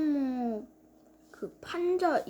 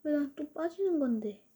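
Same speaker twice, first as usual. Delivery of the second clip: usual speaking voice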